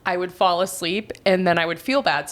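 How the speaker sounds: usual speaking voice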